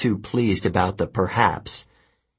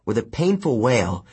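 plain reading voice

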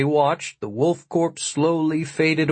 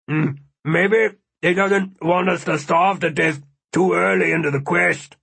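speaking through a mouthful; mouth full; 4 semitones higher than usual. A narrator's reading. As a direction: talk while chewing jerky. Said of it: "through a mouthful of jerky".

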